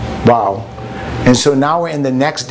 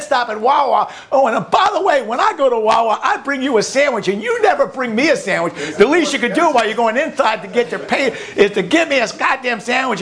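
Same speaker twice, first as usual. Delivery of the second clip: high, aggrieved complaining voice; nagging partner rant; 7 semitones higher than usual. Acted. Partner griping about the empty car tank and donuts. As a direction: pile on the grievances, voice riding high.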